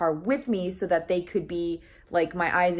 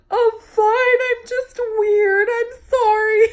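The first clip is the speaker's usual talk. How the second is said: high, tearful voice insisting she's fine; retold sobbing; 6 dB louder, slower than usual. To the driver who bumped her car, high tearful sobbing. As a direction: sob the apology out, pitched way up. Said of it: "I'm sitting there sobbing hysterically".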